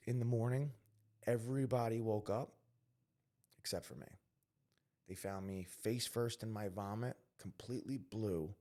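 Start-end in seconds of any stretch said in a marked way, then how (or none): none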